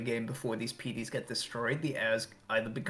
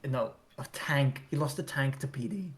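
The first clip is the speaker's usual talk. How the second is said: normal talking voice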